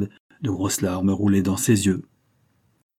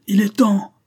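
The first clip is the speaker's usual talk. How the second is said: much higher, louder howl of "Il est temps"; old convict woman howling the signal to revolt; 12 semitones higher, 6 dB louder than usual. A narrator's reading. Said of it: "hurla la vieille Flay".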